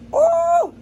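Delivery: high startled cry from the heat; real shrill burn cry; pained yelp. Real, not acted. Spice building.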